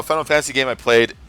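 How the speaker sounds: ordinary talk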